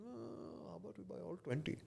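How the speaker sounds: a hint of a Taiwanese accent, quoting the boss. Imitating his Taiwanese boss in a lightly mock Taiwanese accent. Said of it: "you can tell by the accent, he's Taiwanese".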